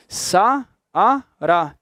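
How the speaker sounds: "sa, a, ra" as three separate beats; syllable by syllable. Shown as a demonstration. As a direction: pause clearly after each syllable of "sa, a, ra". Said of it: "Eu divido as sílabas dessa forma".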